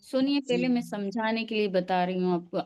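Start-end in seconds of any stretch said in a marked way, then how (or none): none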